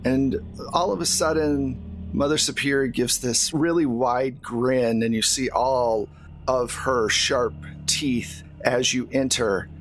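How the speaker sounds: ordinary talk, nothing marked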